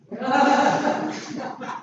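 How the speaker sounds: high, soft embarrassed chuckle; embarrassed laugh. Real, not acted. Pastor laughing at his own post mishap, soft high chuckle. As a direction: laugh at yourself lightly.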